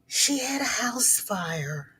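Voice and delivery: hushed whisper. Real, not acted. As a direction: whisper it like grave news. Telling viewers the bad news.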